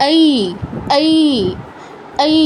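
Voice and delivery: এই as schwa gliding into long i; joined diphthong. Shown as a demonstration. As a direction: draw each এই out from short a into i. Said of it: "এই দুটো একত্রিত করলে". (আ আর ই মিলিয়ে টানা ঐ)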